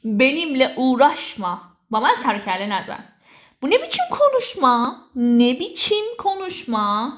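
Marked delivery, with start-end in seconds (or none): 3.6-5.0 high-pitched, affronted question; indignant rebuke. Acted. Models scolding someone's way of talking. 5.2-7.1 slow, drawn-out question; slow teaching repeat. Shown as a demonstration. Repeats phrase slowly for learners.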